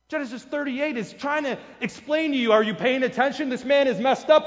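ordinary talk

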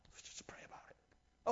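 a hushed, mock-pious aside; mock-pious whisper of a passive believer.

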